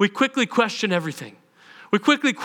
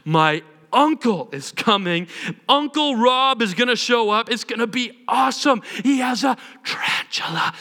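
hyped-up brag, higher pitched; mock excited boast; 3 semitones higher than usual. Telling friends about his cool uncle, excited boast. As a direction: build up the awesome uncle with big emphasis.

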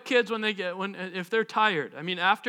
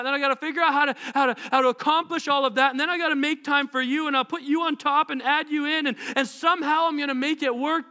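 higher-pitched, overwhelmed plea; frantic complaint; 5 semitones higher than usual. Acted. Busy believer tells God about a full life, frantic and exasperated. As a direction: pour it out like a harried person pleading their case.

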